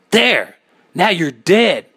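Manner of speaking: high, loud screamed triumph; hysterical scream. A narrator's reading. Narrator smashing the mirror full of eyes, screaming hysterically. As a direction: scream it, on the brink of madness. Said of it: "I screamed".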